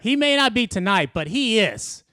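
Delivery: retold heckler. Heckler line retold loudly.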